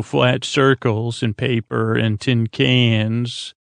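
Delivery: ordinary delivery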